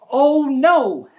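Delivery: gasp, then a loud hammed-up oh no; mock gasp of alarm. Storyteller gasps for the children, hammed-up alarm. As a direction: play up the shock for the kids.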